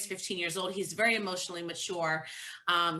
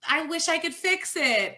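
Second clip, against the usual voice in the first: imitating little kid self; 8 semitones higher, 4 dB louder than usual. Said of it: "and I'm like".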